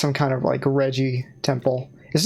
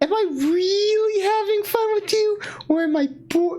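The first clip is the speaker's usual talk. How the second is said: high character voice. Acted.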